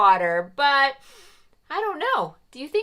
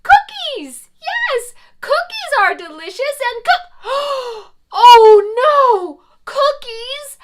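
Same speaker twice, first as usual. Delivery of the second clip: high loud gasp of delight turning to alarm; excited then alarmed; 9 semitones higher, 13 dB louder than usual. Acted. Mother Goose remembers her cookies in the oven, alarmed. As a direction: discover the cookies, then panic.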